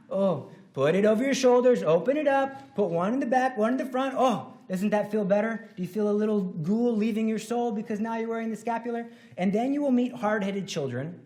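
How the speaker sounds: put-on high, loud teasing coax to kids; bright, bouncy mock delight; playful coaxing. Shown as a demonstration. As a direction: cajole them like a game.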